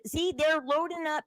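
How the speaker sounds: ordinary delivery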